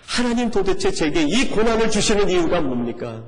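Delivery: loud, high pleading cry to God; tearful outcry. Retold or imitated. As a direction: cry out in anguish, voice climbing on the second 하나님. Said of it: "눈물 흘리며 부르짖게 됩니다".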